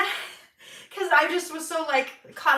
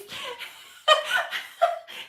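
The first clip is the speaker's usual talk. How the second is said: high unvoiced giggle; real airy laughter; high breathless laugh; 13 semitones higher, 3 dB louder than usual. Real, not acted. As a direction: laugh at your own embarrassment. Laughing at own story.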